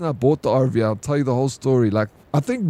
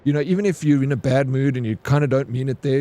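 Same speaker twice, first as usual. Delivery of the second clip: normal talking voice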